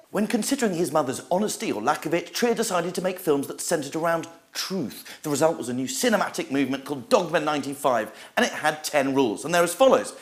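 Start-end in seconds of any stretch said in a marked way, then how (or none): none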